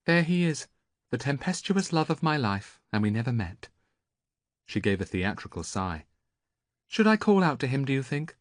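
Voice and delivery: put-on theatrical young woman. A narrator's reading. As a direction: ham up the lost love, teasing.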